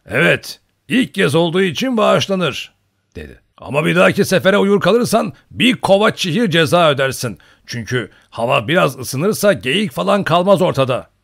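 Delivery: put-on rowdy old hunter's warning; boisterous old hunter. A narrator's reading. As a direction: lay down the forfeit in a big voice. Old hunter lays down penalty.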